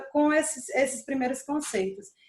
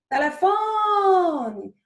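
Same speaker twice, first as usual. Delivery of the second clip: "Telefone" called high with a very long "fo"; calling out; 6 semitones higher, 4 dB louder, much slower than usual. Shown as a demonstration. Teacher calling the word like a person, drawn-out high call. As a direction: shout it like someone's name, stretching "fo". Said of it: "eu grito como se fosse uma pessoa".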